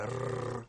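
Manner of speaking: a long trilled R; rolled r. Shown as a demonstration. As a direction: roll the tongue through a sustained R. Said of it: "roll your tongue so you".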